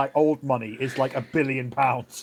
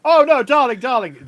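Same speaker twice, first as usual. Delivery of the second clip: gushing posh lady; 8 semitones higher, 10 dB louder than usual. Acted. Kathy soothing the scared maid.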